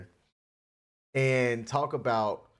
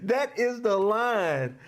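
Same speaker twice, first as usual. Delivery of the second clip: slow, laughing emphasis on that; 7 semitones higher, slower than usual. Real, not acted. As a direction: savour it through a laugh. Laughing, repeating the phrase.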